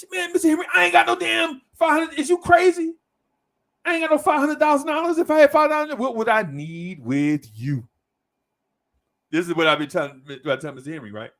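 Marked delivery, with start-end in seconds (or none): 0.1-7.9 very high and louder, comic outrage; incredulous outburst. Retold or imitated. Client to Mister Henry over the money, very high and loud. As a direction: squawk in disbelief at the sum.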